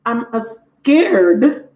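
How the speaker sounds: put-on very slow, drawn-out childish whimper; mock scared toddler; little child voice. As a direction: imitate a small child admitting fear. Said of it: "That's what my son used to say".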